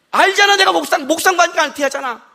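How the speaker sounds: high, loud retold complaint; worked-up venting. Husband complaining to his wife, worked up.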